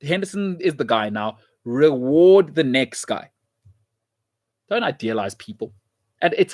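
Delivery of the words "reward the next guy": slow emphatic. Real, not acted. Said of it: "slow emphasis".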